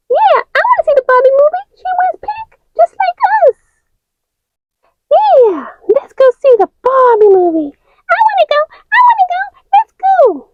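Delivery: high, fast, pleading toy voice; very high eager girl voice; high character voice. Acted. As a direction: beg to go, high. Figures beg for movie.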